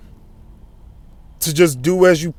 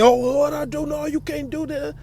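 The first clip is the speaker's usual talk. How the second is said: higher, whiny protest that drops away soft; mock indignant driver; 9 semitones higher, 6 dB quieter than usual. Preacher mocking a driver who argues with police, whiny. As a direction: play the driver arguing back with the cop.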